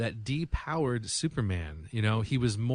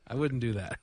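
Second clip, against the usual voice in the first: ordinary talk, nothing marked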